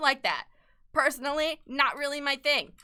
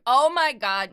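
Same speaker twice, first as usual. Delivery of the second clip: louder excited burst; excited gasp; 3 semitones higher, 8 dB louder than usual. Retold or imitated. Showing her sister the trailer, excited. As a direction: blurt it with delight. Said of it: "I was like".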